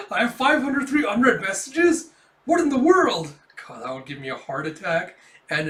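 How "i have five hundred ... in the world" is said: high, loud shocked outcry through a laugh; laughing disbelief. Retold or imitated. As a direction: gasp in disbelief while cracking up. Kafka shocked, laughing while reading.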